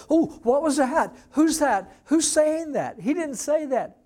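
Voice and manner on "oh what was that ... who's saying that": high, loud flustered alarm; mock panic. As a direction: play a startled man, hammed-up. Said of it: "panics or gets worried".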